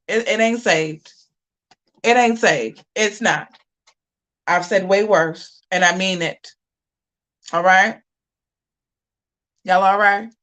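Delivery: ordinary talk, nothing marked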